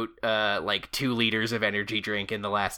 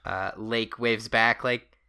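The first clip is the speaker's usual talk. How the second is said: ordinary talk, nothing marked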